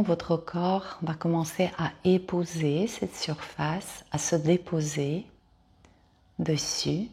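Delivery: calm Yoga Nidra guide with long pauses; guided meditation. Acted. Yoga teacher guiding listeners to lie down and release, guided meditation. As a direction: lead them into rest, phrase by unhurried phrase.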